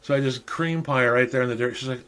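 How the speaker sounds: ordinary delivery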